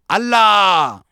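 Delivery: high, loud scream like an enraged lion; long angry shout falling at the end; furious roar. A narrator's reading. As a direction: bellow it from the depths of your gut. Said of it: "बेंबीच्या देठापासून एका क्रोधिष्ट सिंहाप्रमाणे किंचाळला".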